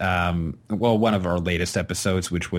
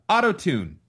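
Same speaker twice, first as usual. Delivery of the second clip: ordinary delivery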